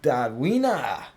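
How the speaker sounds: loud, exaggerated German-accented name. Streamer playing with a character's name, mock German accent. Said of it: "say it with a German accent".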